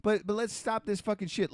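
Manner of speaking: ordinary delivery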